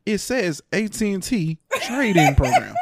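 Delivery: slow, laughing, spelling it out; laughing exasperation. Retold or imitated. Telling his wife the envelope says it, laughing and slow. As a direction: drag it out through laughter, incredulous.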